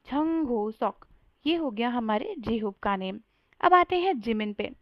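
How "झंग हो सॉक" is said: झंग हो सॉक slowly, breathy झ at the start; Korean Jung Hoseok. Shown as a demonstration. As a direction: spell out झंग हो सॉक syllable by syllable. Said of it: "तो आपको क्या बोलना है?". (झंग हो सॉक धीरे, साफ़ कोरियन उच्चारण में)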